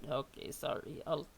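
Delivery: usual speaking voice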